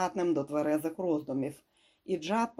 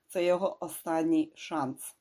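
ordinary delivery, nothing marked

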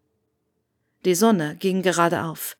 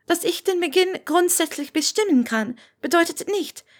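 higher, polite schoolgirl voice; a polite nervous student; young Hermine; 11 semitones higher than usual. A narrator's reading. Hermine voice, misread take.

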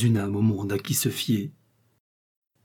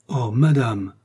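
mild heartfelt cry of protest; fervent exclamation; 3 dB louder, slower than usual. A narrator's reading. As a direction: exclaim it, falling at the end. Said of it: "s'écria la jeune femme". (exclamation fervente, un peu retenue)

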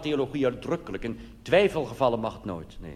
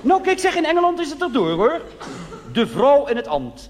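much higher and louder, scandalised; indignant shriek; 14 semitones higher, 7 dB louder than usual. Acted. Church lady reading the news about women priests, shrieking. Shrill outrage over women ministers.

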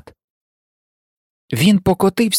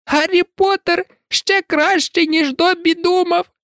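very high elf voice with a light touch of sobbing; tearful house-elf; 13 semitones higher than usual. A narrator's reading. Dobby hugging Harry, moved to tears. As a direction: sob it out gratefully. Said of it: "заридав він".

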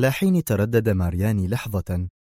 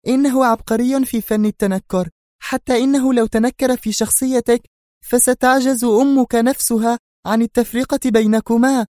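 high, loud woman's voice, exasperated; indignant Sonia; 14 semitones higher, 5 dB louder than usual. A narrator's reading. Sonia explains Adham's disguises to the Don, indignant. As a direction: lecture him, annoyed and insistent. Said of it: "صاحت في حنق".

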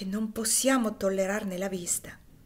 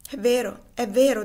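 fervent agreement; 3 semitones higher, 9 dB louder than usual. Acted. Mammy raising hands, agreeing fervently.

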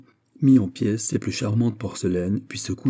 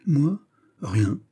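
stammering; 5 semitones higher than usual. A narrator's reading. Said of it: "balbutia monsieur".

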